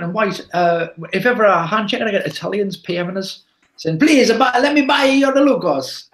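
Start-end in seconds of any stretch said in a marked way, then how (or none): 4.0-6.1 mock Italian accent, pitched up and slow; a pleading Italian buyer, hammed up. Imitating Italian buyers begging for his Lukos, in a mock Italian accent. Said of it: "uh, in that accent".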